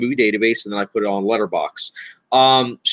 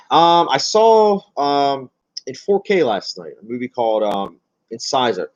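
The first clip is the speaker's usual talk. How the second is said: ordinary talk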